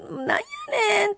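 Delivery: a light play-act of shouting; mock outburst. Host acting out shouting in frustration, mock. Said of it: "言うてみよう".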